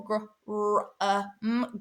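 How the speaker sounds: gr-, r-, uh, m as separate phonemes; sounding out. Shown as a demonstration. Teacher decoding the first syllable, sounding out. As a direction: sound out gr-, r-, uh, m piece by piece. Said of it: "So the first syllable".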